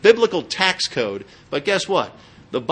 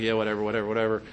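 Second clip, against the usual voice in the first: dismissive; 6 semitones lower, 4 dB quieter, much faster than usual. Retold or imitated. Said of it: "you're just kinda like".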